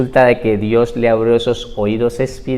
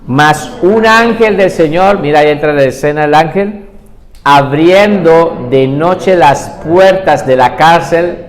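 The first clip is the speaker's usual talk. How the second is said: louder, measured Bible reading; scripture reading; 3 dB louder than usual. Acted. Preacher reads Acts to the congregation, proclaiming. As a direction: proclaim the passage to the church.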